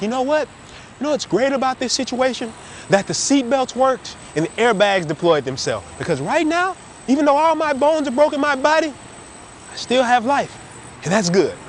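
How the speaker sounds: high, cheery, mildly sarcastic optimist; relentlessly upbeat. Retold or imitated. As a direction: play the overly positive friend. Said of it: "They'd be like".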